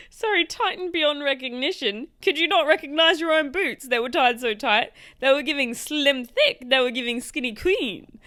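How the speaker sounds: high, loud comic disbelief; amused incredulity. Real, not acted. Reader ridicules the husband's over-tightened boots, amused incredulity. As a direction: riff on it, voice pushed up in mock outrage.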